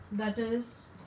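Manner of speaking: ordinary talk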